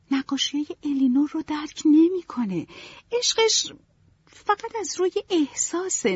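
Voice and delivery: put-on a romantic girl picking apart a dull suitor; young Marianne, critical. A narrator's reading. Marianne complains to mother about Edward, passionately.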